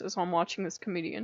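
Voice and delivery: usual speaking voice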